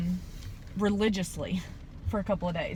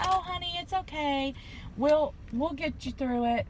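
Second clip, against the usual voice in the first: higher, softer imitated nurse reassurance; soothing nurse; 6 semitones higher, 5 dB quieter than usual. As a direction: coo it like a kind nurse calming a patient. Nurse comforting the scared patient.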